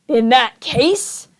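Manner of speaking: slow, measured villain threat; lightly voiced villain. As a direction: savor each word like a schemer. Olaf menaces Violet.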